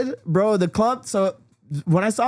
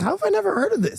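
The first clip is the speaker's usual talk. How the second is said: much higher, fast incredulous question; disbelief; 12 semitones higher, faster than usual. Retold or imitated. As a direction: ask it in total disbelief. Retold disbelief.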